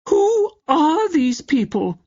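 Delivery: very high, slow haughty grande dame; mock the aunt's snooty drawl; snobbish aunt imitation. Niece mocking her snobbish aunt at dinner, haughty and high. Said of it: "in clear imitation of her aunt".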